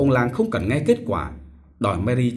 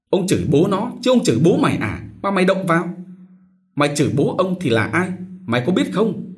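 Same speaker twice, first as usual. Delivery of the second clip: high, loud, fast insults; cursing quarrel; 7 semitones higher, 3 dB louder than usual. A narrator's reading. Son Mão curses his brother in a loud rapid quarrel. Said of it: "chửi nhau".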